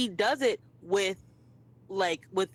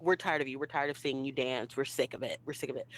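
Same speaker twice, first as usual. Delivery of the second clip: a lower, softer voice, bored and worn out; bored yawning crowd; 7 semitones lower, 4 dB quieter than usual. Retold or imitated. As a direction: drone it like the onlookers are yawning at the dancers. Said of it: "the yawning of".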